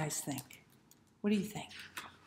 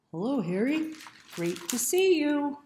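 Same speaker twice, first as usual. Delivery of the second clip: higher, louder cheery hello; George's cheerful greeting; 8 semitones higher, 9 dB louder than usual. A narrator's reading. George greeting Harry, loud cheerful innocence. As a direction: greet him brightly as if nothing happened. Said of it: "Harry is back".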